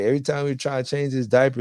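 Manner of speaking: usual speaking voice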